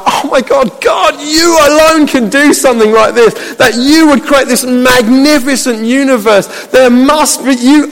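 high, fervent wonder at God; swept-up, high-pitched praise; awed worship. Acted. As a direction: marvel aloud at the Creator. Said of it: "it just brings worship out of me".

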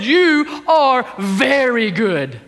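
"very good" drawn out slowly; slow, weighty stress through "You are very good"; emphatic declaration. Retold or imitated. Preacher quoting what God said, slow emphatic declaration.

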